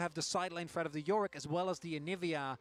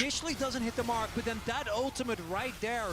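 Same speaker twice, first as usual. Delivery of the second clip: rising excited caster call; calling the teamfight, louder; esports caster; 2 semitones lower, 15 dB louder than usual. Acted. Live play-by-play of a fight.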